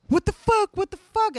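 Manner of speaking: high, loud waking-up panic; panicked yell. Retold or imitated. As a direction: shout like you just woke up tied.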